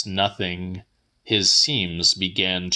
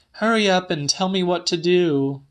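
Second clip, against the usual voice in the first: much higher and fast, impatient; Celia, hurried; 10 semitones higher, faster than usual. A narrator's reading. Woman hurrying the old man at his bedside, fast and high. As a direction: rush her words, pitched well up.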